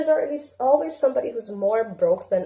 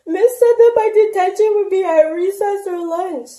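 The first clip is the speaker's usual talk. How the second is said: put-on higher, louder complaining child voice; whiny daughter; 10 semitones higher, 5 dB louder than usual. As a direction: mom playing her daughter whining about recess.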